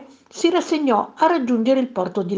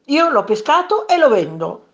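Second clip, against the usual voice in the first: raised, emphatic statement; firm declaration; 6 semitones higher, 4 dB louder than usual. A narrator's reading. Fisherman lays claim to his catch, firm declaration. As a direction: state your claim with pitch lifted. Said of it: "dichiarò il pescatore".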